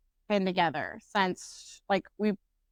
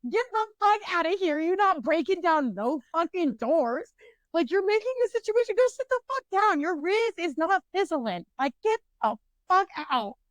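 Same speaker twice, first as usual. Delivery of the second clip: mock telling off; 9 semitones higher than usual. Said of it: "And I was like".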